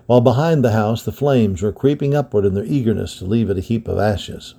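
ordinary delivery, nothing marked

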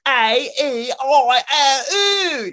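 high, loud, drawn-out Cockney wail; mangled Cockney vowels. Acted. Flower girl botching her vowel drill in wailing Cockney. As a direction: squawk each vowel slowly in broad bad Cockney. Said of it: "you know, like that".

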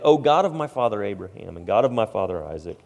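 loud, preached scripture prayer; fervent prayer reading. Acted. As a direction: proclaim the prayer with force. Reads Jacob's prayer aloud.

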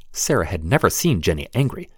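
usual speaking voice

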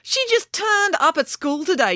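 put-on high girl's voice, loosely yelled; a mild mock yell as the daughter. A narrator's reading. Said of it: "yelled April".

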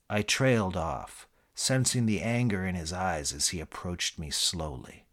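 ordinary talk, nothing marked

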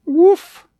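small, high, loud puppy bark; a short high-pitched little woof. A narrator's reading. Puppy answering the tiger in his garden, little high woof. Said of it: "the puppy said".

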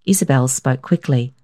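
plain reading voice

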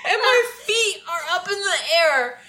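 high giggly voice, laughing through the words; can't stop laughing as she tells it; laughing while telling. Real, not acted. Laughing through the fall story.

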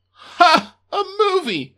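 loud high scornful ha; mocking laugh. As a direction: sneer at the idea. Boss mocks humans.

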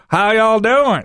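high Southern-style American caricature; mock American accent. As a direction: imitate Europeans hamming up American. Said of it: "their go-to American accent is usually".

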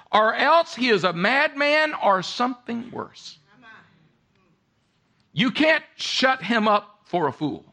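impassioned sermon delivery; preaching at full force. Acted. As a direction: preach it out with rising fervor. Preacher builds to a climax.

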